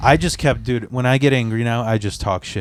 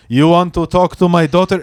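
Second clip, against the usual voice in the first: higher, louder imitated angry father; confrontational dad; 4 semitones higher, 5 dB louder than usual. Dad pushing with his chest, mock confrontation. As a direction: challenge him as a protective dad would. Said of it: "her dad popped out".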